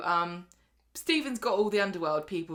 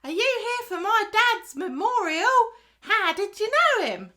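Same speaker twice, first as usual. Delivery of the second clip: put-on child's voice; 13 semitones higher, 6 dB louder than usual. Orphan child asking the mourners, high little voice. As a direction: play the small orphan greeting the guests.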